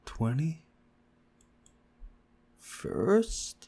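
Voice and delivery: very slow, rising stunned question. Acted. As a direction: let it sink in slowly. Stunned at the century.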